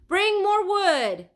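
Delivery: loud, high, slow shout. A narrator's reading. Leonardo calls to the helpers for fuel, loud shout. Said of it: "Leonardo shouted".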